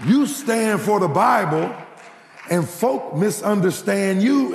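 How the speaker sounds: high, loud, driving sermon voice; fervent preaching. Acted. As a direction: preach it out to the whole church. Preacher rises to full fervour.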